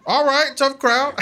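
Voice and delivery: high-pitched awkward deflection; imitating a rattled preacher; mock unfazed pastor. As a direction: shrug off the heckle with forced lightness.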